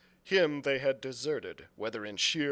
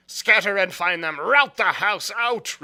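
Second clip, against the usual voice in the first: Pew ordering again; 6 semitones higher, 11 dB louder than usual. A narrator's reading. Blind Pew repeating his order to the crew, loud. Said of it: "reiterated Pew".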